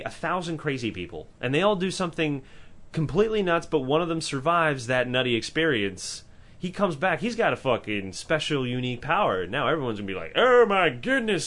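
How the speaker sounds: normal talking voice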